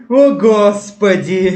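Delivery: slow exasperated laugh; can't stop laughing at the joke; laughing hard. Real, not acted. Streamer laughing at vine.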